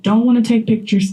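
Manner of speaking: usual speaking voice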